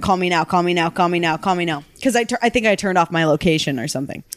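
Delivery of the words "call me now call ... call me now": rapid-fire repetition. Retold or imitated. Imitating dad's texts coming more frequent, rapid-fire. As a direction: run the texts together in a monotone string. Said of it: "it comes more and more frequent".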